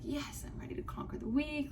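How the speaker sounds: breathy eager whisper. Retold or imitated. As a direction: whisper it like a pep talk. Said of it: "I was like".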